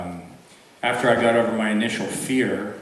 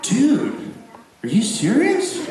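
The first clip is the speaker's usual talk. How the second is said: incredulous; 11 semitones higher, slower than usual. Retold or imitated. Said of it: "And I'd be like".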